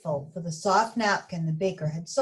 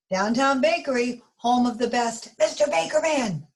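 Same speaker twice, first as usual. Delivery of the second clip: frantic; 5 semitones higher, 5 dB louder than usual. Acted. Baker answers phone, Arnie frantic.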